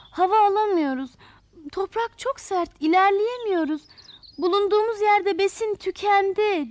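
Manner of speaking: high, distressed plaintive cries; crying out. Acted. Flowers' roots gasping for air in hard soil, crying out. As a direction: wail it high like suffocating little flowers. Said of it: "diye bağırışıyorlarmış".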